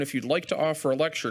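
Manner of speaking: ordinary delivery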